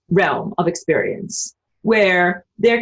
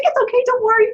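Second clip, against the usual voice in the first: much higher, cutesy pet voice; voicing the dog; faster than usual. Voicing her dog comforting her, high and cute. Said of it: "She's like".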